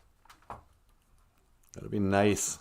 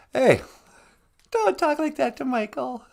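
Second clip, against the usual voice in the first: much higher scolding puppet; 9 semitones higher than usual. Pretend. High puppet scolds another.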